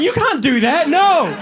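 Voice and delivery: half-laughing outrage at the guide; outraged rant. Retold or imitated. Comic telling the tour guide off, high mock outrage.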